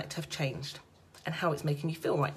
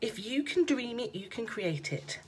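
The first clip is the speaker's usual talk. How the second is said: usual speaking voice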